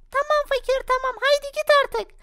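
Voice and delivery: put-on high, sulky kid reply; grudging child agreeing, high. Daughter answering father, high childish voice.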